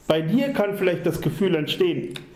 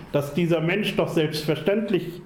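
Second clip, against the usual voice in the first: ordinary delivery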